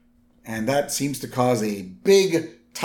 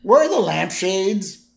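high, polite mock Barney; polite drunk Barney; 6 semitones higher than usual. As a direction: ask the syrup lady nicely. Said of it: "He's like".

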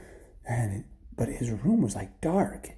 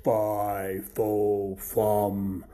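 big, slow, booming chant; menacing giant; 5 dB louder, slower than usual. Acted. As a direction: draw out each word like a threatening giant. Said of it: "this giant says".

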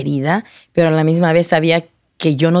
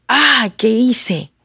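high, drawn-out cry of regret; retold anguish; 5 semitones higher, much slower than usual. Woman reliving her vision of hell, anguished regret.

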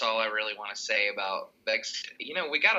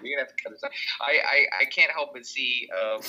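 usual speaking voice

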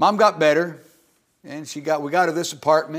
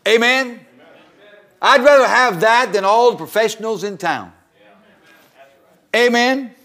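loud, high, fervent preaching; 7 semitones higher, 8 dB louder than usual. Real, not acted. Preacher praising humble singers, loud and high. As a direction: lift the room with conviction.

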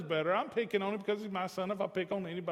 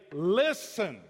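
firm parent; 5 dB louder than usual. Acted. Parent ordering children to listen.

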